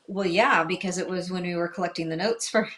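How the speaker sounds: ordinary talk, nothing marked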